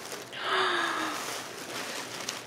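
delighted gasp. Real, not acted. Unwrapping a gift.

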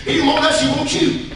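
ordinary talk, nothing marked

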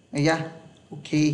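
ordinary talk

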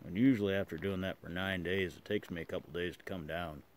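speaking while smoking pipe; talking around a pipe. Real, not acted. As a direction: pipe clenched in teeth. Seen 0: Pipe stem clenched in mouth throughout, smoke visible; references hold it lower.

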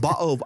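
"Bottle" with a glottal stop; dropped T. Retold or imitated. Imitating Joe's British wife, "Bottle" without a T. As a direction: say "Bottle" as "bo'ul", no T. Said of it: "doesn't say the letter T".

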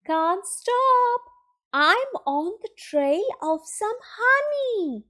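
high, stretched character voice; Pooh calling back. A narrator's reading. Pooh on the trail of honey, calling back. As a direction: call it out high while hurrying off. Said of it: "Pooh called back".

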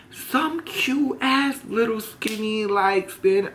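high, slow, gossipy surprise; amused surprise. Real, not acted. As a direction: savor the shocking reveal. Describing surprise boy.

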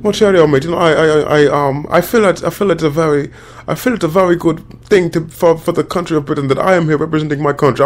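high-pitched put-on British accent; hammed-up British award-show interviewee; mock British accent. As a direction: imitate a halting British actor, stammering. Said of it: "who's from Britain".

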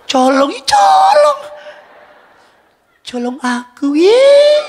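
high, loud squealed gossip; mock-gossip squeal of an angel.

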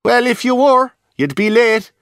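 a high chatty dancer calling down; high-pitched chatty Irish dancer; reel dancer. Acted. Dancer teases.

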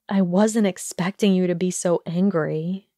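plain reading voice

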